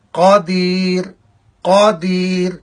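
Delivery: قدیر with a heavy, full-mouth qaf; correct pronunciation. Shown as a demonstration. Teacher demonstrating the correct heavy qaf in قدیر. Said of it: "اس کا تلفظ ایسے ہوگا".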